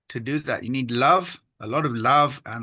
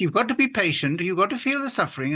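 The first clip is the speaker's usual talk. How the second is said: raised, sing-song lecturing tone; preachy advice voice; 7 semitones higher, faster than usual. Retold or imitated. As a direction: sound like a know-it-all giving advice. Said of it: "I say".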